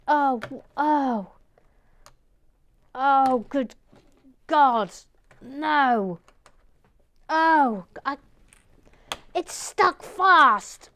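loud, high cries of exasperation; panicked wailing. Real, not acted. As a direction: wail in panic at something stuck fast. Panic at piece stuck fast.

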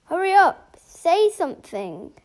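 urgent loud demand; impatient shout. Pretend. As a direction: snap at the slow doctor. Impatient with the doctor.

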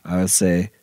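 normal talking voice

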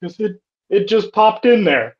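high, sheepish admission; sheepish confession. Retold or imitated. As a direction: own up to it, halting and helpless. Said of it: "He's like".

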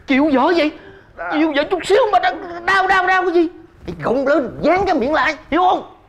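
scolding. Acted. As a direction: scold him hard in a sharp pitch. Grandmother scolds grandson harshly.

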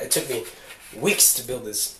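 breathless and fading; out of breath, pushing the words out; running out of air. Real, not acted. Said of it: "I'm running out of breath".

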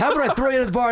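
lightly acted outrage, voice lifted; mock angry bartender. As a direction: imitate an indignant bartender's threat. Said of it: "'cause the guy was, like, mad".

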